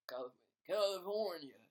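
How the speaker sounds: exaggerated California accent on the state's name; mock Californian accent. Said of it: "What else is there in America?".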